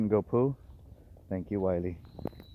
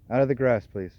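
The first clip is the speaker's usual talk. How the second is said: ordinary delivery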